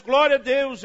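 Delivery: loud exclamation of praise; excited praise. Retold or imitated. Preacher shouting praise at the cash. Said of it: "Eu começo".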